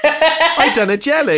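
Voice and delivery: high, loud, drawn-out boast with laughter; mock proud. Podcaster mocking Aunt Mary's pride in her jelly, mock proud. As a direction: crow it in a hammed-up proud voice. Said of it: "really proudly".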